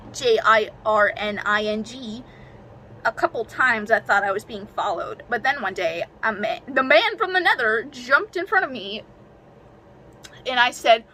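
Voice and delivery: usual speaking voice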